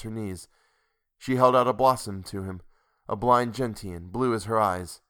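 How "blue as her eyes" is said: usual speaking voice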